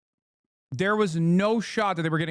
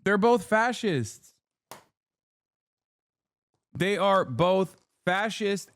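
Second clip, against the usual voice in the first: slow, each word weighted and deliberate; firm slow insistence; slow emphatic declaration; 3 semitones higher, slower than usual. Real, not acted. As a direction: spell it out slowly so it lands. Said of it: "slow emphatic restatement".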